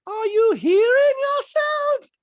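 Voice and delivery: very high, loud, stretched shriek impression; Diana. Diana challenging Sutton, high loud slow impression. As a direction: mimic her incredulous and piercing. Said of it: "And Diana's like".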